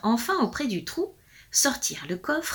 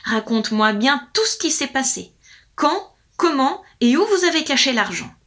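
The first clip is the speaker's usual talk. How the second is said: firm demand, growing louder; harsh questioning; 5 semitones higher, 5 dB louder than usual. A narrator's reading. Lord interrogates wife about hidden money, harsh. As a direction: hammer each question at the witness. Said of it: "reprit durement le Seigneur".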